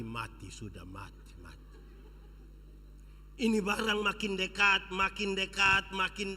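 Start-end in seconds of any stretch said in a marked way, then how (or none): 0.0-1.8 very low, very soft terrified murmur; fearful inner whisper. Acted. Child hiding from a lion, terrified hushed murmur. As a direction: breathe it as dread takes hold.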